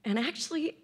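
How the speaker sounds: fighting back tears mid-sentence; choked up, trying to keep talking; real crying. Real, not acted. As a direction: voice catching with tears. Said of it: "so I don't know why I'm crying".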